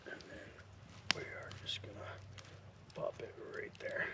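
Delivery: soft unvoiced whisper. Real, not acted. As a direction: whisper it close to the mic. Quiet narration over the campfire.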